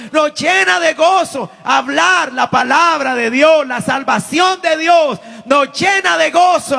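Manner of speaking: high, loud, fervent preaching on joy; full-force preaching. Acted. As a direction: preach it out to the congregation. (predicación a todo pulmón, alta y fuerte)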